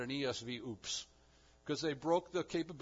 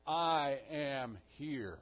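slow declaration; slower than usual. Acted. God declaring slowly with authority.

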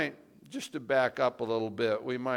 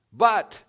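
but punched much louder and higher; stressed but; 7 semitones higher, 11 dB louder than usual. Shown as a demonstration. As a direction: slam the word but as the turning point. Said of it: "he's putting heavy emphasis on that word "but".

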